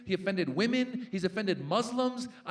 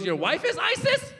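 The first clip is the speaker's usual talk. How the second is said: very high-pitched shocked question; confused kid; 13 semitones higher, 4 dB louder than usual. Retold or imitated. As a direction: squeak it like a bewildered little boy. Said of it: "The kid was confused".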